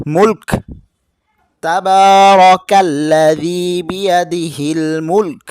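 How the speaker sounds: loud recitation. Shown as a demonstration. Teacher demonstrating the pause reading, loud and chanted. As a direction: call the verse out strongly and slowly. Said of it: "তখন এভাবে পড়বেন".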